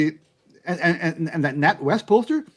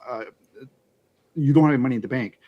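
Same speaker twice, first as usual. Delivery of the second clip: ordinary talk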